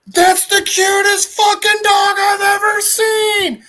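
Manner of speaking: very high, loud, slow squeal of delight; gushing over a puppy. Retold or imitated. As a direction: coo like you just saw the cutest dog.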